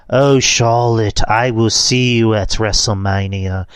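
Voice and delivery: slow, soothing baritone wrestler promo; slow soothing Rhea Ripley. Retold or imitated. Wrestler calmly threatening her rival, slow and soothing. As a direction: drag the threat out calmly, almost lulling. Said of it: "she has this very baritone voice".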